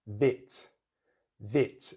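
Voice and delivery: vit with a short relaxed i. Shown as a demonstration. Teacher modeling British short i in vit. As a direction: clip the vowel in vit short and lax. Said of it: "the short vowel sound, I".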